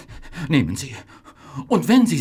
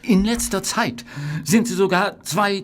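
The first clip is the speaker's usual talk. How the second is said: usual speaking voice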